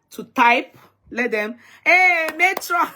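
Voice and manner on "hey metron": excited call. Real, not acted. Calling out a viewer.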